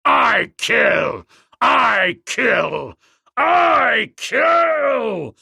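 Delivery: a war chant climbing to a shriek; bull ape screaming. Acted. The king ape threatening the ape man, building scream. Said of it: "screamed the great bull".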